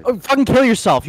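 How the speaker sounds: loud angry outburst, pitched high; angry shout. Real, not acted. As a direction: yell it back at the insult. Angry reply to chat insult.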